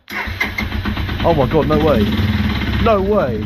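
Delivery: high, loud disbelieving shriek; shrieking jackpot winner. Real, not acted. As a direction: scream it like you just won big. Engine suddenly fires up.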